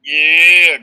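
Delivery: a big, loud yeah; shouted yeah; loud cheer. Real, not acted. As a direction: hype the room up. Cheering along loudly.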